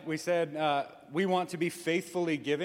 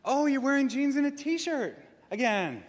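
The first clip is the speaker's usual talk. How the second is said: high, disapproving sing-song; mock passive-aggressive churchgoer, pitched up; judgy remark; 9 semitones higher, 4 dB louder than usual. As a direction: let the judgment drip through the tone. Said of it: "Inflection means everything".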